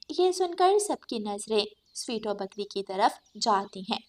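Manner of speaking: ordinary delivery, nothing marked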